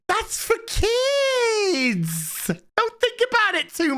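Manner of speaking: high, slow mock wail; mock whiny wail. Mocking fans who defend the show, high slow wail.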